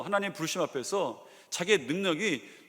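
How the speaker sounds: usual speaking voice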